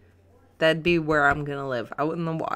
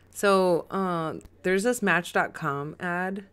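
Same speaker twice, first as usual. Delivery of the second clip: normal talking voice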